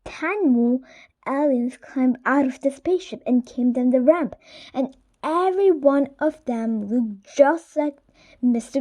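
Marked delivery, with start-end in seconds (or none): none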